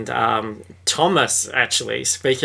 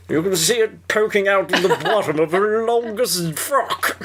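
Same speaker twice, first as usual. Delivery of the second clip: put-on posh old gossip character; 8 semitones higher than usual. Servant gossiping about young Mary, hammed-up and disapproving.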